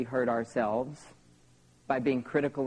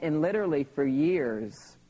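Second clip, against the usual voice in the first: ordinary talk, nothing marked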